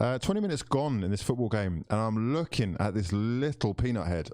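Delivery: ordinary delivery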